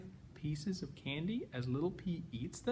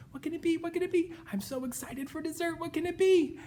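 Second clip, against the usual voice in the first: put-on high, eager kid voice; excited child; 11 semitones higher, much faster than usual. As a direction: bounce with excitement. Little P eager.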